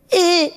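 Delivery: short high-pitched "eh"; imitated high squeal under squeezing; snake charmer's squeal. Charmer signalling the python to let go, high squeal. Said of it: "he'd make a sound".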